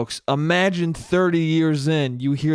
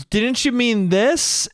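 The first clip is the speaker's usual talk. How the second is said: imitated slow, higher-pitched nitpicking question; needling partner; 7 semitones higher, slower than usual. Partner picks at your slip, mock needling. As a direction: ask it slowly and high, to irritate. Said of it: "your partner says to you".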